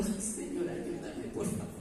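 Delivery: soft whispered prayer, half laughing; whispered plea. Retold or imitated. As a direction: breathe the prayer under your breath. Retelling her own quick prayer. (súplica susurrada entre risas)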